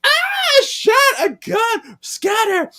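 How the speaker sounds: very high, loud crowd scream; mock panic. Everyone reacts to a gunshot, high mock-panicked shouting. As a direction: shriek like a panicked crowd, hammed up.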